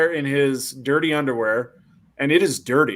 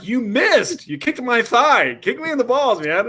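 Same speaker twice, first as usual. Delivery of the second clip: high, strained protest; mock pained complaint; 8 semitones higher than usual. Kicked man complaining of a miss, high mock-pained voice. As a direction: imitate a hurting man pleading insistently.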